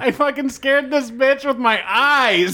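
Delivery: high, slow, delighted; gleeful laughing brag. Real, not acted. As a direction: crow about it, half-laughing. Gleeful brag after the story.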